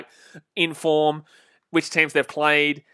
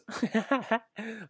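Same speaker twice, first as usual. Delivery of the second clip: soft laugh; 8 semitones higher, 9 dB quieter than usual. Real, not acted. Laughing at a viewer's comment.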